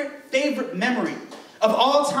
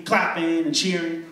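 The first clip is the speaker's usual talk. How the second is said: ordinary talk, nothing marked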